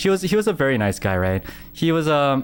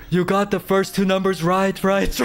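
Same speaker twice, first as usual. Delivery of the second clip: raised, drawn-out voice imitating a distant hint; higher-pitched mock call; actor calling from afar; 6 semitones higher than usual. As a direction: mimic the actor hinting from across the room, on the edge of laughter. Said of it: "And then from a distance".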